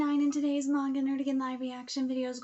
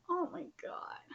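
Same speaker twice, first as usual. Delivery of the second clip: awed whisper; 6 semitones higher, 5 dB quieter than usual. Real, not acted. Overwhelmed reading emotional chapter.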